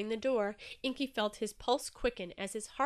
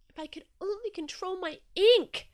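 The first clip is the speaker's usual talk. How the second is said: crying out; 9 semitones higher, 7 dB louder than usual. Acted. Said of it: "cried Inky".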